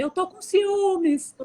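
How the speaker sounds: put-on high, slow little-boy voice with a laugh; slow high child voice, half laughing; imitating little son. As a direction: play the small boy admitting he's jealous. Son's answer retold.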